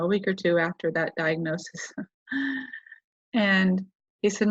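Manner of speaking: ordinary talk, nothing marked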